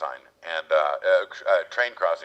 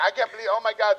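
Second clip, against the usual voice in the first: mock excited squeal; 13 semitones higher than usual. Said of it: "she's like".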